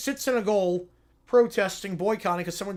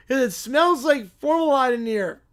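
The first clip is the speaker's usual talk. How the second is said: put-on louder, bratty little kid; 4 semitones higher, 6 dB louder than usual. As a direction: sass him like a know-it-all preschooler. Kid answers the motel man.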